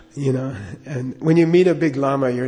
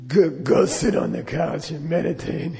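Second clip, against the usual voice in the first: slow, damaged-voice lama giving orders; Darth Vader. Retold or imitated. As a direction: imitate a stern teacher with a crushed voice box. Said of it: "he liked to talk like that".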